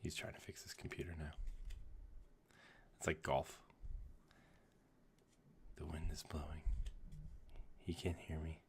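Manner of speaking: hushed golf commentary voice; golf announcer whisper. Real, not acted. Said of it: "like in my golf voice".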